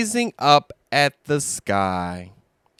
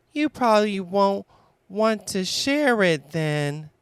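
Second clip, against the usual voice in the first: disappointed Piglet; 6 semitones higher than usual. Acted. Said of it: "Piglet was disappointed".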